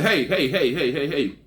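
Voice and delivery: repeated hey, called out with a laugh; hailing her across the lot, half laughing; calling out. Retold or imitated. Calling out to the neighbor's wife across the lot, half laughing.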